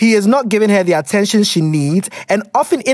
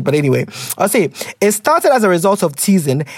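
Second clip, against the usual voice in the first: ordinary talk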